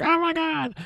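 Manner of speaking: high, soft imitation scream; mock freak-out. Woman stumbling into the control room, high-pitched mock freak-out. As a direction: squeal it in pretend horror, held back in volume. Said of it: "now she's freaking out".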